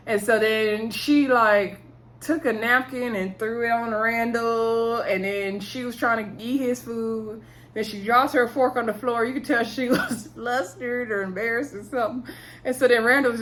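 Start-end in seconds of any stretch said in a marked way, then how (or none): none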